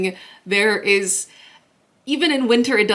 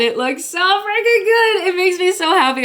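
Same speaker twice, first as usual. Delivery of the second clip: high, loud burst of delight; excited gushing; 11 semitones higher, 5 dB louder than usual. Real, not acted. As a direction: gush over it, pitch soaring. Gushing over new kitchen wreaths.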